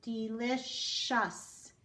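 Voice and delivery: "Delicious" said very slowly, syllable by syllable; slow demo word. Shown as a demonstration. Teacher reading the word slowly for students. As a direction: stretch out "Delicious". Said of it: "hear the last syllable".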